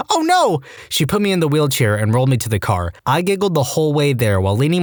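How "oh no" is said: high, startled cry of alarm. Retold or imitated. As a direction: yelp it out as someone crashes down. Nurse sees patient fall.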